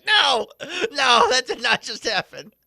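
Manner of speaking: high, loud, slow mock-stunned voice; hammed-up shocked friend; mock disbelief. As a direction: act floored that it happened. Gary's reaction voiced.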